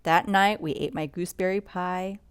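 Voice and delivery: usual speaking voice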